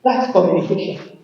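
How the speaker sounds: ordinary delivery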